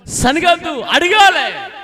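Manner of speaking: high, loud call-and-response line; emphatic teaching shout; loud prompt. Shown as a demonstration. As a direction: shout it out for the congregation to repeat. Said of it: "చెప్పండి గట్టిగా".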